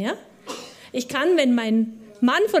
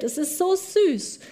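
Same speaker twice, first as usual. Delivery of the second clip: higher, slow, gushing over something cute; doting coo; 6 semitones higher than usual. Retold or imitated. Mother gushing about her playing child, doting coo. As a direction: melt over the cute little one.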